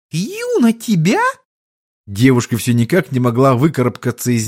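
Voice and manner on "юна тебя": put-on high childish echo question; puzzled little girl. Child asking if she poisoned him, puzzled squeak. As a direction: pipe it up, confused.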